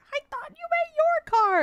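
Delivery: very high-pitched retold protest; hammed-up frantic complaint; exasperated squeal. Daughter explaining the misunderstanding to mom, exasperated squeal.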